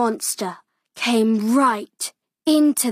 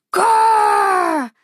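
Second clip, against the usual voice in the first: a loud, higher mock growl behind the door; monster growl; 6 semitones higher, 4 dB louder than usual. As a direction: growl to scare the little girl, playfully. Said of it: "said a voice".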